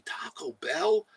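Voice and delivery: high, hushed whisper of Taco Bell; whispered, teasing question. Acted. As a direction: breathe it like a secret craving. Chat begging for Taco Bell.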